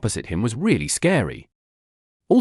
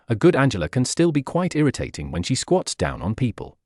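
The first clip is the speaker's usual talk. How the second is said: ordinary delivery, nothing marked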